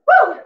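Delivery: whooping cheer; cheer woo. Real, not acted. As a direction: whoop to keep them going. Coach cheering during workout.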